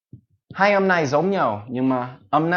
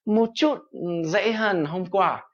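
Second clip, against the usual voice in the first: higher, lightly delighted "oh, easier today"; pleased surprise; 8 semitones higher than usual. Retold or imitated. Teacher voicing a learner pleasantly surprised by progress. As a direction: pretend to notice progress with a pleased lift. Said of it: "các bạn sẽ thấy là".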